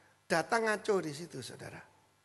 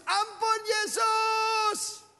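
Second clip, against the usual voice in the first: high, slow, loud cry for mercy; desperate plea; 13 semitones higher, 4 dB louder, much slower than usual. Retold or imitated. The possessed thug begs Jesus, a high slow anguished wail. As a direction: wail it out as if in pain.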